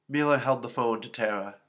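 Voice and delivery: plain reading voice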